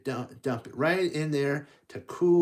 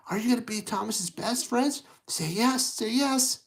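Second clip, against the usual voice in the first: higher, lightly voiced worried little engine; a hint of a scared engine asking for friends; nervous Thomas; 6 semitones higher than usual. Thomas asking kids to come along, lightly nervous.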